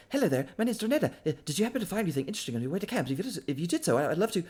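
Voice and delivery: an eager explorer rattling off questions very fast; rapid-fire chatter. Acted. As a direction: babble eagerly without a breath. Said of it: "this is gonna be fast".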